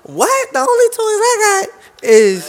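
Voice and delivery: put-on high, slow whiny girl voice. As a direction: play it as a whining girl. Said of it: "That's my girl voice".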